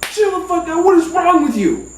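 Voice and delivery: high, acted scolding; exasperated cousin. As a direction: demand that the panicker calm down. Cousin slaps and scolds him.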